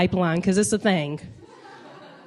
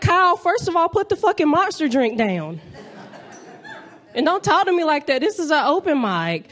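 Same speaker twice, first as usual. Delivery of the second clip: higher-pitched indignant telling-off; mock scolding; 5 semitones higher than usual. Comic telling off Kyle at an open mic, mock indignant. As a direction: play at putting a heckling know-it-all in his place.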